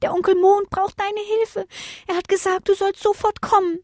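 very high, small creature voice; high squeaky answer; little stars. A narrator's reading. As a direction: pipe up with an urgent message. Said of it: "antworteten die Sterne".

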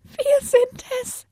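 very high, slow, timid voice; tiny scared voice; trembling little stars. A narrator's reading. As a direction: squeak it out fearfully. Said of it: "mit zitternder Stimme meldeten sich die Sternchen".

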